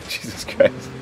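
slow, laughed-through, nearly whispered; chuckling in disbelief; laughing. Real, not acted. Laughing after a crazy jump.

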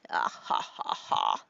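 breathy mock villain cackle; playful devilish cackle, trailing off; evil laugh. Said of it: "That's my evil laugh, my devil laugh".

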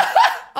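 short high-pitched cackle; high laugh. Real, not acted. Streamer scared of what L made, high laugh. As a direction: burst into a quick high laugh. Nervous about the video.

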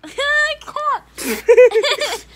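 high, loud, helpless laughter; laughing. Real, not acted. Girl failing to pull drama-class faces, laughing. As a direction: crack up mid-sentence. Breaking up while showing faces.

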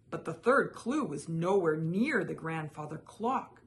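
usual speaking voice

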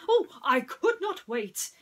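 high, loud eager outburst as Prince John; excited cry. Acted. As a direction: burst out with impatient glee. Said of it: "he cried".

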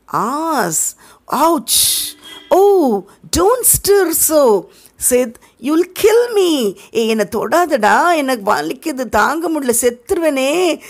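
high, hammed-up cry of pain; fake agony. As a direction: ham up the agony. Tom faking pain. (போலியான வலியில் அலறும் உயர்ந்த குரல்)

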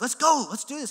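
loud, high rallying call; urging on. Retold or imitated. Urging his crying friend on.

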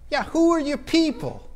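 put-on high, insistent old local; mock-insistent local, pitched well up; old Savannian. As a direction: press the question as an imitated old-timer. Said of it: "I'm talking old Savannians asking me".

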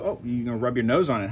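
normal talking voice

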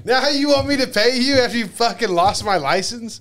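retold exasperation at corrupt cops; indignant outrage. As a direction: hit them with outraged disbelief. Officials demand payment after losing license.

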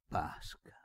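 whispered, barely voiced; whispered name. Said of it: "cominciò il vecchio".

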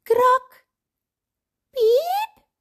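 high, loud imitated noises; sudden crack and squeak. A narrator's reading. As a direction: startle them with a high crack, then a squeak.

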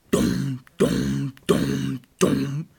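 loud, even booming dums; heavy footstep thuds. A narrator's reading. Great Big Billy stomps onto the bridge, heavy thuds. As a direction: thud out each step evenly.

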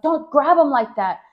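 retold angry defence of a brother; mad. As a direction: act out a protective outburst. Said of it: "I got so mad".

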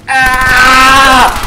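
short shout. Real, not acted. Shooting in game.

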